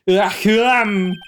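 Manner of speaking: loud, high theatrical ahem; over-the-top throat clearing. Acted. Lawyer announces himself to visitor, over-the-top ahem. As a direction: clear the throat hugely for attention. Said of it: "most over-the-top clearing of the throat".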